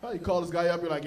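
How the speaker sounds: ordinary delivery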